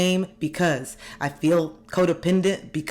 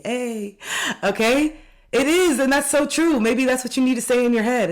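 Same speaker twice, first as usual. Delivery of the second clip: much higher and louder, suddenly bright energy; loud high excited outburst; excited burst; 9 semitones higher, 5 dB louder than usual. Real, not acted. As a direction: jump up excitedly. Bursts out after whispering.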